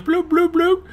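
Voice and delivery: very high little bloop noises; silly high-pitched bubbling imitation; imitated fish bloops. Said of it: "and goes".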